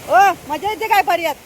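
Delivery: calling out to her husband bathing in the stream, loud and high. Real, not acted. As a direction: shout it over to him in the stream.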